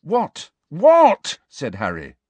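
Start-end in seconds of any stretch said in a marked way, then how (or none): 0.0-0.5 high, short impatient question; impatient retort. A narrator's reading. Harry snaps back, then repeats louder. 0.7-1.4 loud, high, angry question; exasperated shout. A narrator's reading. Harry repeats it much louder.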